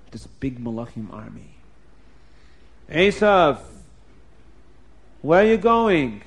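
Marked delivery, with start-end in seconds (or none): 2.9-6.2 calling out. Acted. Angels confront Esav again.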